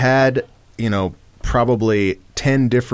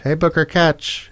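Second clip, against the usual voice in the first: same line, pitched higher; 6 semitones higher than usual. Shown as a demonstration. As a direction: shift the pitch up. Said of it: "different pitches, like, up and down".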